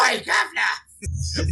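high, drawn-out fake British shout; Greg Miller's British accent. Mimicking the host showing off, high mock British accent. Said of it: "This is a British accent".

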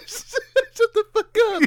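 high, slow laughing squeal; laughing hard. Retold or imitated. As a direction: stretch it out cracking up. Imitating shushing campers.

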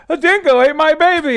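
high, loud mocking quote; mock her blurting it out, high and loud; imitating co-host. Teasing his co-host by quoting her shrill dingo line. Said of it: "and you're like".

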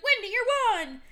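high excited call; tossing it to her, mock yelling. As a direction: shout it to Wendy. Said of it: "Casper's like".

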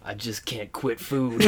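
low, slow, hushed drama; mock heartfelt confession. Rat parting from cowboy, hushed melodramatic confession. Parody of tearful lovers' line.

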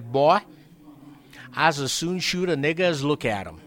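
loud, high threatening boss; Tom's voice. Retold or imitated. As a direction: bark it like a menacing old boss. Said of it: "Excuse my, uh, acting up".

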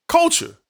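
"Culture." repeated high and hard, alone; emphatic repeat. Real, not acted. Co-host stressing the team's new culture, one emphatic word. Echoing his own point on culture.

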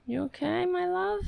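high, doting pet voice; tender cooing. Real, not acted. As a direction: coo it up high to a beloved pet. Soothing the upset pet.

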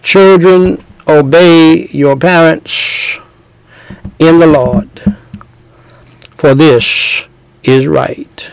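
slow, solemn reading of the verse; scripture reading. Acted. Preacher reads Ephesians to the children in church, slow and solemn. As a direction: read the commandment with weight. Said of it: "here are the commandments of God".

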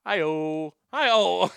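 booming sidekick catchphrase; Ed McMahon. Shown as a demonstration. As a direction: call it out like a TV announcer. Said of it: "I love doing Ed McMahon".

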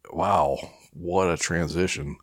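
ordinary talk

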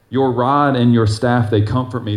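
rod stressed, read with reverence. Acted. Verse declaimed slowly.

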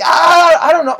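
high, loud flustered yelp; mock panic. Accused cousin defending himself to relatives, mock panic. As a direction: play up helpless panic, pitch way up.

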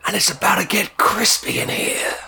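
whispered comic persona; breathy whisper, deadpan. Acted.

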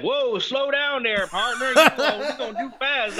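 high-pitched, slow Southern drawl; mock Southern accent. Southerner telling the Yankee visitor to slow down, mock Southern accent. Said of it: "down south, they'll be like".

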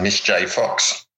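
ordinary talk, nothing marked

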